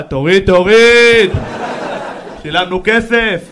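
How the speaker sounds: loud, slow chant from the back; rowdy heckler. Pretend. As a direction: bellow like a heckler demanding a show. Heckler in the crowd. (צעקה של צופה חרמן מאחורה)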